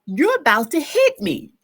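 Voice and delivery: high, loud yell of hey stop; alarmed high shout; retold scream. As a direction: shout a sharp warning at the reversing truck. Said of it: "So I scream".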